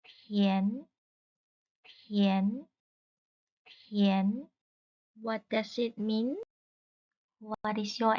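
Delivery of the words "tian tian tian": teacher modelling "Tian" on a rising tone; Mandarin rising tone. Shown as a demonstration. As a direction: say "Tian" with a question-like upward sweep. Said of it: "Listen carefully".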